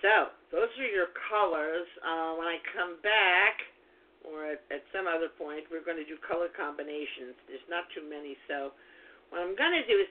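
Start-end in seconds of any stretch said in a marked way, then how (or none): none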